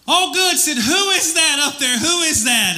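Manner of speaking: high, eager calling voice; relieved jogger. Acted. Hanging jogger calls to the unseen voice, high and eager. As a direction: call upward, high and urgent. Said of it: "the jogger says".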